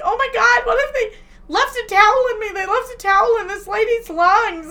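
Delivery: high, loud, frantic wail; hammed-up tearful panic about a towel; mock panic. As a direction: whine it like an imitated meltdown. Retelling panicked crying call.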